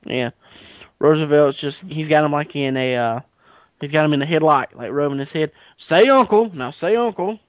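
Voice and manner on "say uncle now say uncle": loud, high playground taunt; taunting bully. Pretend. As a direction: lord it over him, gleeful. Roosevelt bullying Jackson in headlock.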